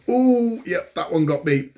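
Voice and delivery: high pained yelp easing down; winded yelp. Retold or imitated. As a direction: caught by an elbow, high gasp then wry. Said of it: "I'm like".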